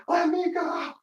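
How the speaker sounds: high squeaky plea to be let go; pinned man begging in a thin squeal; squealing to be released; mock squeal. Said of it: "he's like".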